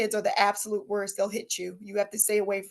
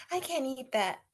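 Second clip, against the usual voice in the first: mock dieting Kourtney; 3 semitones higher, 8 dB quieter than usual. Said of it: "look at something like".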